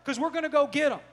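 loud, rallying sermon delivery; rally the congregation, driving each phrase home; full-force preaching. Acted. Preacher telling church they are sons and daughters, loud and rousing.

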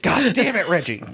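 mock curse.

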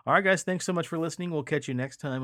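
high, bright host sign-off; upbeat sign-off. Acted. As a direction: wrap up the show with energy. Podcast sign-off again.

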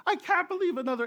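high-pitched imitated shriek; retold scream. Teen lying in the grass screams again, high and fast. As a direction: squeal it in mock despair. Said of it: "and he's just screaming".

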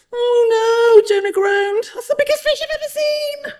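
squeaky-high panicked wail; groaning. A narrator's reading. Jonah sights the enormous fish, groaning in panic. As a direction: climb to a very high squeal, ending quick.

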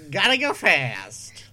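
loud, failed cartoon hedgehog attempt; failed Sonic. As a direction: belt out a poor Sonic imitation. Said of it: "That's not a Sonic voice".

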